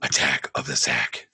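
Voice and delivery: deep, gravelly-low Batman growl; hipster Batman voice. Acted. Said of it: "My hipster Batman voice".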